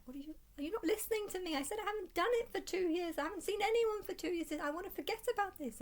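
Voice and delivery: high, fast, indignant; exasperated outburst. Retold or imitated. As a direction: fire back in disbelief, pitched right up. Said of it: "I'm just like".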